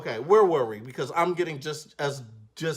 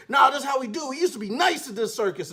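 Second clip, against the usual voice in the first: dad insisting; 9 semitones higher, faster than usual. Retold or imitated. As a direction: push it like a stubborn dad. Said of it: "He's trying to, like, say".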